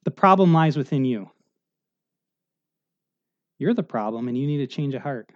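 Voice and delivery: quietly convict the listener; a soft, low voice of the Spirit; gentle Spirit voice. Retold or imitated. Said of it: "The Spirit says".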